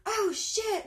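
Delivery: normal talking voice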